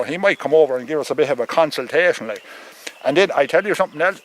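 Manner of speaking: usual speaking voice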